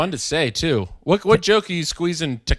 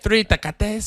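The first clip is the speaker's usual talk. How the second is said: put-on showy Spanish accent; 5 semitones higher, slower than usual. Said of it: "it does feel goofy".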